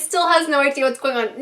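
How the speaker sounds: normal talking voice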